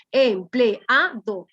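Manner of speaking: empleado chopped very slowly into em-ple-a-do; syllable by syllable. Shown as a demonstration. Teacher counting four syllables for the class, empleado split slowly. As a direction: break empleado into four separate beats, e and a apart. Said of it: "tienen que separarse aquí".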